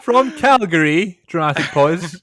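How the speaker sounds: slow, loud, drawn-out wrestling announcer; hammed-up ring announcer intro; mock ring announcer.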